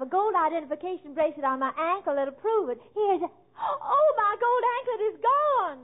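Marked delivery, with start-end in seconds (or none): 2.9-5.8 sudden loud gasp of alarm, slowing then rushing; shocked cry. Acted. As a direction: cry out that the anklet is gone. Discovers her anklet stolen.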